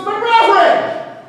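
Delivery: loud, very high, drawn-out objection; incredulous protest. Acted. Farmer objects to bringing ducks indoors, high incredulous protest. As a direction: wail the objection in comic disbelief. Man protests the duck advice.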